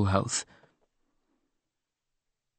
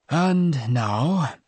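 lightly voiced solemn vicar; a hint of a gentle officiant; trained funeral voice; 3 semitones higher than usual. A narrator's reading. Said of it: "his trained funeral voice".

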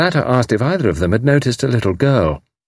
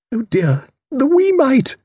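very high, distressed little cry; fluttery pity; slower than usual. A narrator's reading. Miss Minton pities the kidnapped child, distressed. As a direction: pipe it up high in dismay.

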